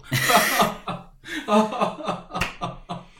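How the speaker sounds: long, high, loud laugh; loud laughter. Real, not acted. Man amused by his own anecdote, long loud laugh. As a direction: burst out laughing at your own story.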